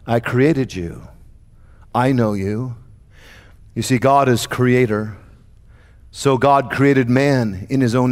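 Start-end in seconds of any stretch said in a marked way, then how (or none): 0.0-2.8 slow, soft and low; God reassures Moses quietly; tender voice of God. Acted. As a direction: say it gently, as a creator to his child. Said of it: "he says".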